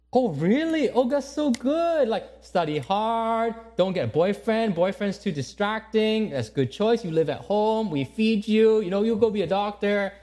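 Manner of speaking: gushing proud mother, slow and sing-song. Acted. Mother praising her daughter's choice to study medicine, high and gushing. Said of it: "Conversation goes like this. Mom".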